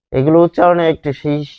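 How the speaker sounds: ordinary talk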